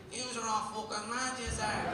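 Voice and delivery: put-on slow, a lightly done Dublin accent; Dublin barman accent. Dublin barman teasing the Derry man, lightly voiced Dublin accent. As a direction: drawl it like a Dublin local. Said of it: "He's like".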